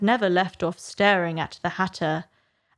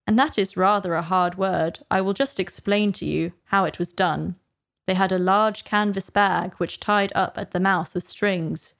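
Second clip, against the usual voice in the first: plain reading voice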